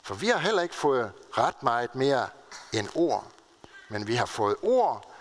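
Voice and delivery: usual speaking voice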